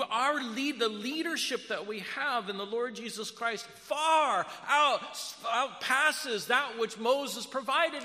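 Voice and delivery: high, loud, impassioned sermon voice; full-force preaching. Real, not acted. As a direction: build to a shouted climax.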